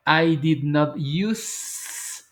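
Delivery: drawn out word by word; very slow demo. Shown as a demonstration. Teacher showing negation to students, very slow. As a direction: stretch every word of I did not use. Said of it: "Ya le quité la D".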